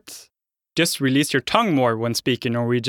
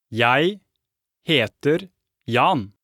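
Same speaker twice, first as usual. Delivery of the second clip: normal talking voice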